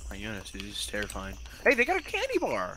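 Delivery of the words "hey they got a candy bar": excited. Real, not acted. Finds item in scary house.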